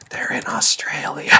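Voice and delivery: whispered joke, slow, voiceless. Retold or imitated. Whispering a joke to a companion in the cinema. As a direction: lean over and breathe it at a moviegoer. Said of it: "I leaned over ... I was just like".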